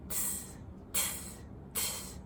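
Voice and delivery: whispered tss tss tss; monkey tsking. Acted. Monkeys answering the peddler, whispered tsking. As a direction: tut at him like a cheeky monkey. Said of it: "and said".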